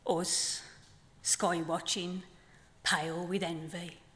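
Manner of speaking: measured poem delivery with long pauses; poem reading. Acted. Poet reading her flamingo poem to the festival audience, measured. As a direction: let each line land, unhurried.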